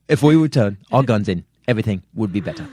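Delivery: London actor impression, loud then settling; Michael Caine. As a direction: declaim it as a Cockney film star. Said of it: "That's Michael Caine".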